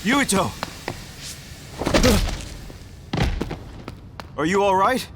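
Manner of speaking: high, urgent shout of concern; alarmed calling out. Acted. Rescuer calls to collapsed comrade, alarmed shout.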